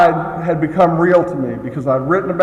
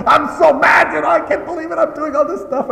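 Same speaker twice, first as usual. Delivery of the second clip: angry rant; 11 semitones higher than usual. Retold or imitated. Angry sponsee venting on the phone, high shout. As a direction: mimic a sponsee ranting down the phone. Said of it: "he calls me up".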